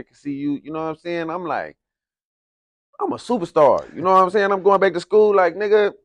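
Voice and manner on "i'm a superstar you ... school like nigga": loud, high, hyped-up boasting; excited bragging. Retold or imitated. Kid back at school bragging to classmates, loud and hyped. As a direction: puff up like a twelve-year-old star.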